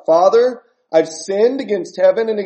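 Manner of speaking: loud, high pleading cry to the father; loud confession. Retold or imitated. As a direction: call it out like a desperate son. Son's rehearsed confession to father.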